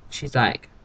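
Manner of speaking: ordinary delivery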